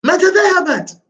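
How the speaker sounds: very high-pitched questioning role voice; dialogue questioner. Acted. Teacher voicing the dialogue's questioner for a student, very high voice. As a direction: ask it far above your normal pitch. Reading both parts of a textbook dialogue.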